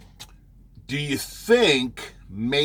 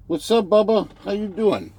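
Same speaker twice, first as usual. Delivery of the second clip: high, loud greeting to a dog; pet talk; 9 semitones higher, 4 dB louder than usual. Real, not acted. Driver greets his dog Bubba, high pet talk. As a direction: pitch it way up, as to a beloved pet.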